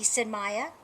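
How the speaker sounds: ordinary delivery, nothing marked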